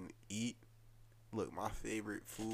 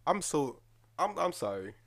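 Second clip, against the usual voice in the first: louder, stumbling, overemotional apology; about to cry; 10 dB louder than usual. Real, not acted. Apologizing to co-host for getting emotional over food, close to tears. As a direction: apologize while trying to hold emotions together. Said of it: "I really feel like I'm about to cry".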